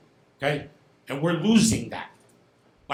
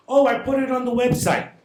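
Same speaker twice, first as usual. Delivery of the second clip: mocking quote; 8 semitones higher, 7 dB louder than usual. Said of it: "coming over here and saying".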